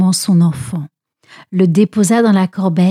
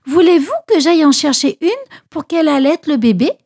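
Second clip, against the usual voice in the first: quick, higher, eager question; eager offer; 6 semitones higher, faster than usual. A narrator's reading. Marie offering the princess a nurse, eager and quick. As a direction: rush the offer out hopefully.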